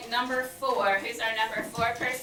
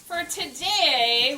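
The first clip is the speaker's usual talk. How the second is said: ordinary delivery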